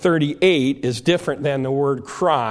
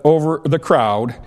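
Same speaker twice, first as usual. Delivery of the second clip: ordinary talk, nothing marked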